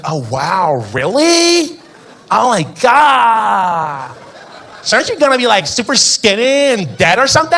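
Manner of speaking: put-on high, gasping ditzy girl; shocked American girl. Beach girl hearing about aids, high shocked squeal. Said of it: "She's like".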